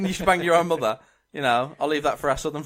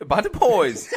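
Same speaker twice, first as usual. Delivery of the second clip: badly faked Geordie through laughter; mangled Newcastle accent; Geordie attempt; 3 semitones higher, 4 dB louder than usual. Contestant obeying the host's request, failed Geordie accent. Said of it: "at least say Bad Boys".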